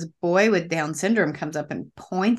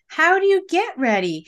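indignant; 11 semitones higher, 6 dB louder than usual. Retold or imitated. Indignant retold thought.

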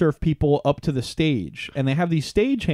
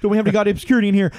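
very fast, higher irate aside; mock yelling; 6 semitones higher, 4 dB louder, much faster than usual. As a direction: imitate a frontman yelling mid-song. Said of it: "go right to yelling at these people".

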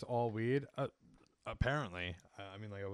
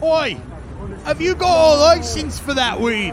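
put-on much higher, much louder London accent; British cop, roadman style; 14 semitones higher, 19 dB louder than usual. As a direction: yell it like a London copper. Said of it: "The cops are like".

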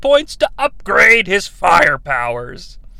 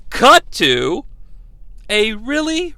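ordinary delivery

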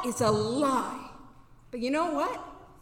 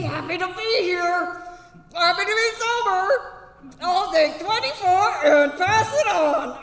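put-on chipper meeting voice, a bit higher; fake cheerful answer; 3 semitones higher, 4 dB louder than usual. Newcomer answering fellow members, forced cheer. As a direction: paste on a brave face and chirp it. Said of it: "what am I gonna say?".

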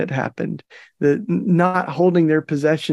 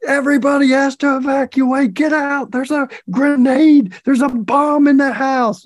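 higher, louder urgent command to clear out; retold bomb squad order; 9 semitones higher, 3 dB louder than usual. Bomb squad ordering the whole block out of the house, urgent higher shout. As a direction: bark the evacuation order like an officer. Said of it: "and then the bomb squad's like".

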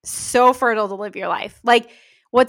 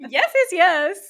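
high, giggly yeses; laughing agreement; 8 semitones higher than usual. Real, not acted. Co-host agreeing with the host at sign-off, laughing. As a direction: agree through real laughter.

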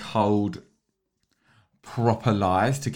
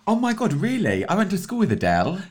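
put-on high-pitched, prim British nanny accent; hammed-up British accent, much higher; showing off a British accent; 8 semitones higher than usual. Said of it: "Why you sound like fucking Nanny McPhee".

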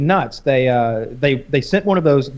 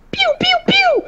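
very high pew pew noises; mock blaster sounds; 3 dB louder than usual. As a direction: fire off toy laser sounds.

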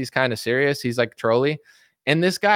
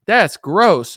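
loud, higher dismissive verdict; mock disgust; 5 semitones higher, 6 dB louder than usual. Jesse judging the pastor, loud mock disgust. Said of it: "And Jesse's like".

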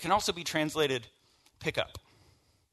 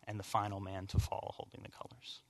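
hushed whisper; 5 semitones lower, 11 dB quieter than usual. A narrator's reading. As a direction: breathe it out, almost voiceless. Solemn end of war story.

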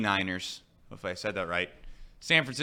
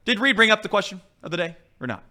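mock interrogation; 8 semitones higher, 4 dB louder, faster than usual.

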